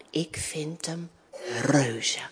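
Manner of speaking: low, soft, slow story ending; contented. Acted. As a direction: savour the last words quietly. (tevreden, zacht en langzaam)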